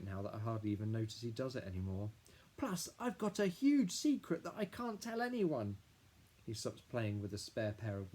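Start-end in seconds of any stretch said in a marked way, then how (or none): 2.6-5.8 put-on high, louder eager kid voice; excited little boy. A narrator's reading. Little brother Billy telling Dad about the mud, high eager kid voice. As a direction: gush like a small boy bursting with news.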